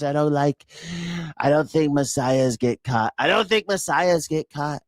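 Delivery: high, whiny self-pitying voice; whiny baby voice. Retold or imitated. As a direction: whimper like a spoiled child. Mocking Trump as whiny victim.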